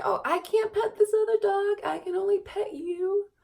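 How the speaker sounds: put-on high, loud baby-talk voice; baby talk to dog. Telling her jealous dog he's the only one, high baby talk. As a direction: coo at the jealous pup.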